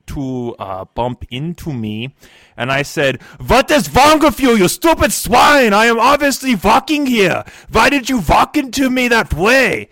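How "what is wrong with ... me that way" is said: high, loud angry shouting at a stranger; furious yell. Retold or imitated. Hearing aid yelling at Beethoven who bumped him on the street, furious shout. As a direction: blast him like he just shoved you. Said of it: "I would just yell at him in the manner".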